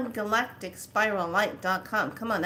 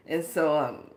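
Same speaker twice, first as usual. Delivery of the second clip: ordinary talk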